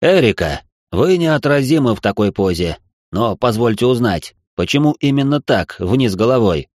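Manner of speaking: gently teasing lord, deep; deep male voice. Acted. Marquis character's voice.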